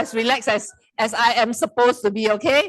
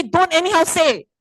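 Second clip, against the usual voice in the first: higher, louder sharp telling-off; mock scolding; 6 semitones higher, 5 dB louder than usual. Speaker scolding ChatGPT for talking nonsense, mock angry. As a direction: snap at the chatbot playfully. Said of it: "sometimes I scold".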